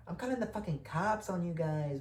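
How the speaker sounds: high, put-on voice of an angry sister; mock-voiced sister threatening to call the cops; sister threatening police. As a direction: caricature her calling the cops. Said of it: "but however Mexican chicks talk".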